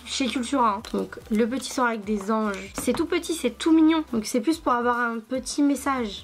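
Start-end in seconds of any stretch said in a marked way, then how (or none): 2.7-4.0 cute gush. Real, not acted. Cooing over a tiny deck.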